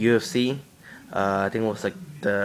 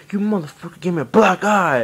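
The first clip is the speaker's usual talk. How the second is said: high, loud, tearful accusation; crying and pissed; 9 semitones higher, 5 dB louder than usual. Retold or imitated. Beaten kid at the door yelling at the boy who hit him, crying. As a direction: yell it through angry tears. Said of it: "crying and pissed".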